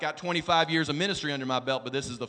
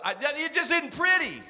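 ordinary talk, nothing marked

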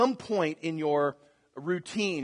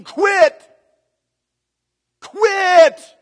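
loud high shout; screaming quit; 13 semitones higher, 13 dB louder than usual. Retold or imitated. Inner voice screaming at the tired runner, loud high shout. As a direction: yell it like your body's giving up. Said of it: "everything in you is screaming".

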